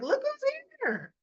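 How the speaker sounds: sing-song greeting. Retold or imitated. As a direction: chirp it up high like a fake-cheerful hostess. Chrissy greets mother's surprise arrival.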